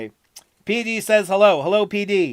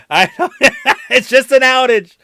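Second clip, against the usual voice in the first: laughing; 5 semitones higher, 7 dB louder than usual. Real, not acted. Laughing at outage.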